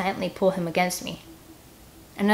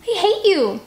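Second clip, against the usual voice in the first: high loud shout; 11 semitones higher, 5 dB louder, slower than usual. Acted. Scarlet shouting at Finn, high loud shout. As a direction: hurl it at him. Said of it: "Scarlet shouts".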